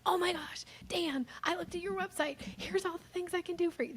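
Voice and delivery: high, gushing sales pitch; high eager "Oh my gosh"; overeager dog-calling energy. Shown as a demonstration. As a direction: gush at the prospect like an eager puppy. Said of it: "the dog calling energy of like".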